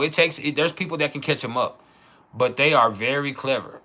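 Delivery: ordinary talk, nothing marked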